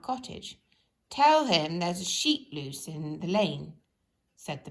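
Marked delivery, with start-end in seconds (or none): 1.1-3.7 firm policeman voice. Acted. Policeman gives message.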